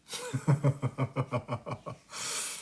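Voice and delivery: long, soft rippling chuckle; real chuckle. Real, not acted. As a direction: chuckle quietly to yourself at the catchphrase. Laughs after quoting a catchphrase.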